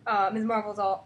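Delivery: ordinary delivery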